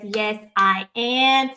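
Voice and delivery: normal talking voice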